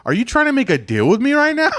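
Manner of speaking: high-pitched incredulous challenge; mock-indignant courtroom question; retold indignant judge. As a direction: question him like an affronted judge. Judge challenges him in retold hearing.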